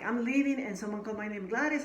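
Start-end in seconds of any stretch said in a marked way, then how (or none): 0.0-0.6 panicked. Retold or imitated. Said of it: "And I was like". 1.5-1.8 calling out a name. Retold or imitated. Someone calls her name.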